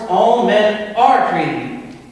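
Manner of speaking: "all" and "are" loud, high and slow; slow, forceful weight on "are"; ringing declaration. Acted. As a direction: drive home "all" and "are". Climax of the closing argument.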